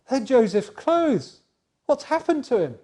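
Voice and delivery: loud, high, slow alarm; alarmed father. Acted. Jacob recognising Joseph's clothes, loud and alarmed. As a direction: cry out in dread.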